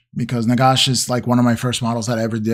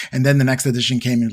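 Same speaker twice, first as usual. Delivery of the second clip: ordinary delivery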